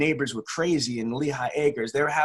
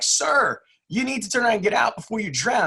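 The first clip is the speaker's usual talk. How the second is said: urgent call; 8 semitones higher than usual. Retold or imitated. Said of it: "And he's like".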